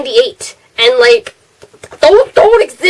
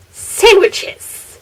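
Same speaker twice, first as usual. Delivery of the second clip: a lightly voiced mom answering; mom's matter-of-fact reply, lightly put on; imitating mom; 8 semitones higher than usual. Mom misheard the question, lightly mimicked flat answer. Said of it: "her response was".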